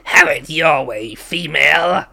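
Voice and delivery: lightly voiced old gloating man; a hint of a gloating old man. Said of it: "I went with the".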